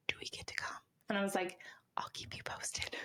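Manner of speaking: hushed whispered retelling; soft conspiratorial whisper. Retold or imitated. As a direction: whisper it like a secret.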